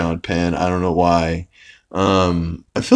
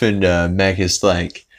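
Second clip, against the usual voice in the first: usual speaking voice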